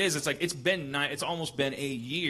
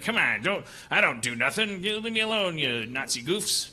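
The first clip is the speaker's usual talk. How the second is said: Humphrey Bogart impression. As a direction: play a tough old movie star. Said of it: "your impression of Humphrey Bogart".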